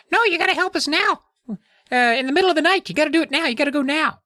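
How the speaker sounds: very high, pushy gnome voice; tiny gnome demanding it now; insistent squeaky gnome. Retold or imitated.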